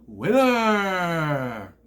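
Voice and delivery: drawn-out, high, loud Winner!; mock gloating shout. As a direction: crow it like a champion. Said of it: "And he goes".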